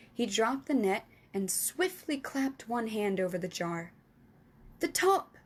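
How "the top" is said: plain reading voice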